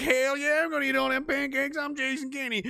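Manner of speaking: high, boastful voice bragging about pancakes; mock proud Kenney. Premier boasts he'll eat them all, high and boastful. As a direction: brag like a proud pancake glutton. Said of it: "He's like".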